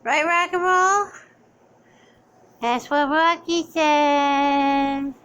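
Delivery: slightly high, slow cooing to the dog; pet baby talk. Acted.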